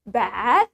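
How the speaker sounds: "bath" with the A held longer, rising; British long A. Shown as a demonstration. Teacher leading practice of British "bath", long A. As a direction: stretch the vowel in "bath". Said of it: "we usually pronounce the A longer".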